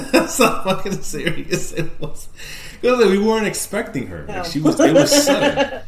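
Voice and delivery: laughing hard mid-story, pitch jumping up; can barely get the words out for laughing. Real, not acted. Telling friends about the scare, laughing hard.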